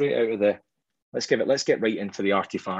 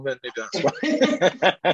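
laughing tease; 4 semitones higher, much slower than usual. Real, not acted. Teasing guess about the price.

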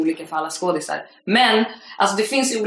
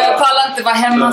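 acted, louder plea, stress on "pallar"; hammed-up despair; 5 semitones higher, 7 dB louder than usual. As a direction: overplay the misery to sell it. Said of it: "Skådespeleriet". (överspelad förtvivlan, högre, betoning på "pallar")

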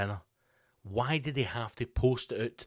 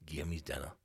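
much softer, lower grumble to himself; muttered grumble; 6 semitones lower, 8 dB quieter than usual. Retold or imitated. Old regular demanding his dinner, in a soft low grumble. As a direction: grumble it under the breath like a hungry old man. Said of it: "I was like".

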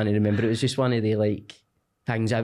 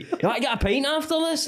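high-pitched mock pub pal; over-friendly punter. Punter inviting the mortgage adviser for a pint, over-friendly. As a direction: put on a high, chummy voice. Acts chatty customer to bank worker.

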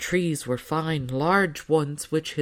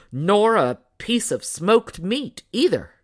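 grumbling father; 5 semitones higher, 5 dB louder than usual. A narrator's reading. Father adds grumbling afterthought.

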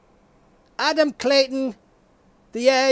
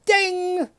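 high sung ding; mock game show ding; ding chime; 7 semitones higher than usual. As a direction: imitate the correct-answer bell.

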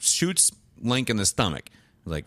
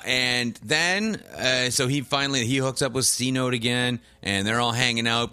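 ordinary delivery

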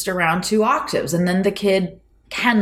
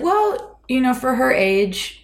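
strained-polite, pitched way up high; 8 semitones higher than usual. Retold or imitated. Piano teacher softening bad news to a dad, high polite voice. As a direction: go high, trying not to be mean. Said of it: "your voice goes all high".

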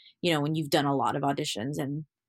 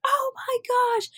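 overjoyed squeal. Retold or imitated. Parents' joyful reaction.

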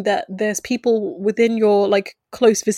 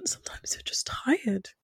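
weary whisper, almost no voice; 12 dB quieter, slower than usual. Real, not acted. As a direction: let it fall to a tired whisper. Tired, drops to a whisper.

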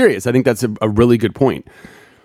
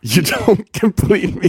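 laughing; 8 semitones higher, slower than usual. Real, not acted. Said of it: "You're so sweet".

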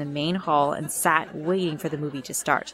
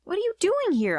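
much higher-pitched cry of surprise; shocked cry; 13 semitones higher than usual. A narrator's reading. Girl to her unmasked big sister, shocked cry. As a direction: voice leaps up in disbelief. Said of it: "I cried".

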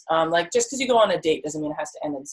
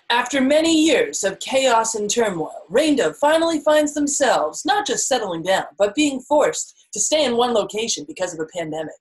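higher, dramatic blurb-reading voice; synopsis narrator; 5 semitones higher than usual. Pretend. Summing up own life chapter, playful dramatic synopsis voice. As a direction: narrate it like a teaser for a series. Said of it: "if we were to synopsis it".

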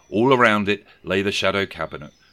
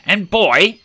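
much higher, louder call; bellowed; 12 semitones higher, 10 dB louder than usual. Acted. Scrooge bellowing after the running boy, loud. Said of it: "he bellowed".

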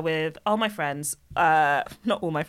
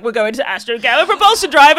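dad's announcement; 7 semitones higher, 12 dB louder than usual. Retold or imitated. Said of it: "be like".

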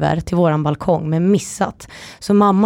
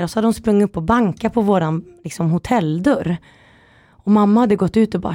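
ordinary talk, nothing marked

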